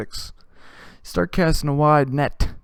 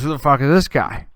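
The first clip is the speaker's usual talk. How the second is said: loud, higher demanding question; mock angry challenge; 3 semitones higher, 7 dB louder than usual. Vern demanding to know who the buddy is, loud. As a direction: put it to the stranger, half angry. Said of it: "Vern looks at me and goes".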